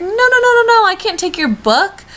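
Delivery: high, loud, flustered no-no-no; flustered refusal. Retold or imitated. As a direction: insist you can't possibly take it. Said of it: "And I was like".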